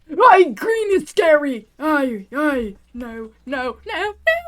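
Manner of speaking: loud, swooping wail of alarm; scared cry. Real, not acted. Player frightened by the green creature, loud panicked wail.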